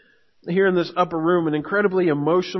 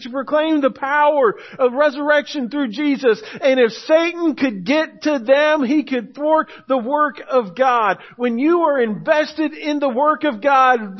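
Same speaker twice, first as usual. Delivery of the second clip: full-force preaching; 6 semitones higher, 3 dB louder than usual. Acted. Pastor warning the church about Satan, fervent preaching. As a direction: preach it to the rafters.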